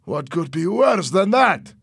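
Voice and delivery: high, loud outraged protest; heated yelled rant; indignant shouting. A narrator's reading. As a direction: argue back at full voice. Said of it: "shouted Louis".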